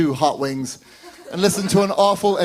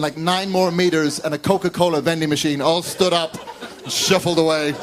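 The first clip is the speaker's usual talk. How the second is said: ordinary delivery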